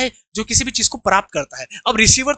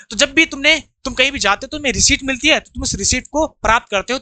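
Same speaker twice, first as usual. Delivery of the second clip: ordinary talk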